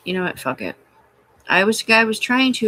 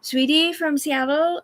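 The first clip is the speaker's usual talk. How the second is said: higher, slow mock-sweet address; sarcastic sweetie; 9 semitones higher, slower than usual. Real, not acted. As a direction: drip fake sweetness. Addressing the operator mockingly.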